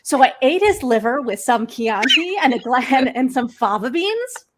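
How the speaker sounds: cheerful contrast to the monotone; lively upbeat read. Shown as a demonstration. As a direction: sing it out brightly, full of melody. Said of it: "Can you imagine if he had said it".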